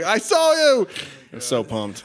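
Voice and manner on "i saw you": much higher, thrilled call; excited shout-out. Retold or imitated. Friend posting about seeing him on TV, excited. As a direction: cheer at a friend on TV. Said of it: "be like".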